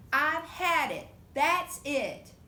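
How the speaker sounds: loud exasperated mother; loud fed-up mom; fed-up mama. Acted. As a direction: snap it like a mom at her limit.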